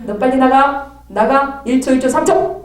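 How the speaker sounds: loud command to leave; commanding shout. Retold or imitated. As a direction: drive the spirit out. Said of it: "막 그러면".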